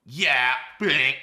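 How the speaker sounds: low loud caller. Pretend. Caller answering.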